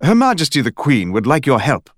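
solemn British detective, Majesty stressed; detective passing on the Queen's request in a British accent. Acted. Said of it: "It also had an accent".

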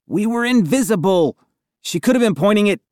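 high, indignant protest; trailing-off protest. A narrator's reading. Boy arguing with friends about the prophecy, high protest. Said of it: "My voice trailed off".

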